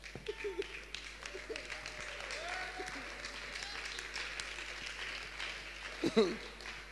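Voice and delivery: high wheezing silent laughter; long breathy laugh. Real, not acted.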